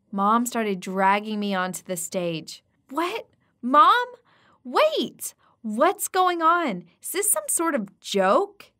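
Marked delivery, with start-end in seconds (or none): 2.9-8.7 high, alarmed disbelief; shocked protest. Acted. Daughter dragged onstage at her engagement, high shocked protest. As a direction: cry out in confusion.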